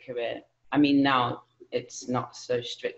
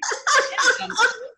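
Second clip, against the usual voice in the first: laugh; 5 dB louder than usual. Real, not acted. Laughing off the accent request.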